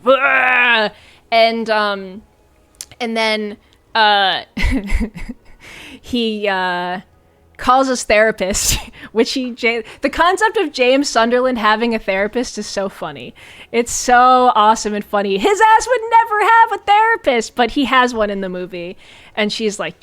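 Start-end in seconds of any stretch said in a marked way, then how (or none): 0.0-0.9 raised mock growl of rage; mock drunk roar. 15.4-17.5 much higher mocking squeal. Real, not acted. Mocking the idea.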